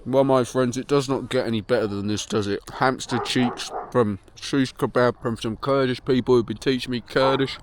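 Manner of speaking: normal talking voice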